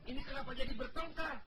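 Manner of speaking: laughing scolding. Acted. Driver breaks up fight laughing.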